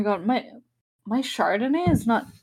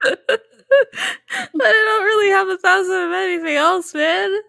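higher, breaking up with laughter; laughing; 8 semitones higher than usual. Real, not acted. Admitting they lack a thousand of anything, helpless laughing. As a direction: squeeze it out through laughter. Laughing at own silly answer.

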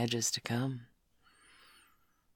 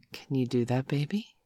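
ordinary talk, nothing marked

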